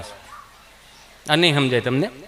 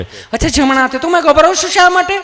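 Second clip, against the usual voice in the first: higher, sweet nurse voice; loving nurse; 8 semitones higher than usual. Retold or imitated. Nurse soothes frightened Gabho, higher and sweet. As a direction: reassure a jittery patient fondly. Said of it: "તમે કેટલું પ્રેમથી પૂછો છો".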